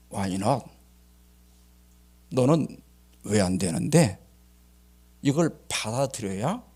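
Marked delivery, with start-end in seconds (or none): 0.1-4.2 low, measured voice for God; God answering. Retold or imitated. God answering those who feel wronged, calm low challenge. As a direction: lightly voice God, lower and deliberate.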